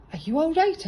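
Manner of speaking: high, caring check-in; concerned question. A narrator's reading. Rabbit checking on Owl who hurt his knee, concerned. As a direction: ask with real concern.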